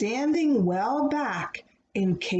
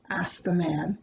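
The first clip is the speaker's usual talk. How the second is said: plain reading voice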